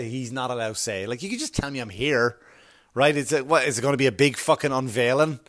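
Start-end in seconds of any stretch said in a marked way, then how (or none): none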